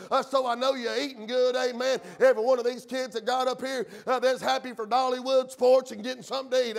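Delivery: rapid-fire, driving pulpit delivery; full-force preaching. Real, not acted. Preacher rallies church not to sugarcoat truth, full-force preaching. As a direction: preach hard without letting up.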